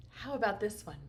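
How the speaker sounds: ordinary talk, nothing marked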